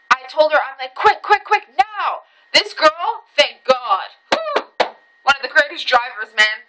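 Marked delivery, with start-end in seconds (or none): 1.0-2.2 high, insistent re-enacted order without shouting; retold urgent command. 4.3-5.0 acted high-pitched exhale of relief; a quick "whew" after a near miss; high relieved whew.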